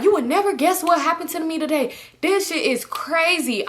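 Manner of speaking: high exasperated imitation of him; irritated retelling. Boyfriend venting about his crazy day, exasperated. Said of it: "so irritated".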